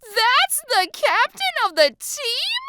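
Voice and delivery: slow, high incredulous shriek; loud high-pitched outburst; animated shriek. A narrator's reading. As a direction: shriek it in outraged disbelief. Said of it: "Animated, she shrieks out".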